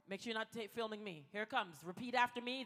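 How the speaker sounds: ordinary talk, nothing marked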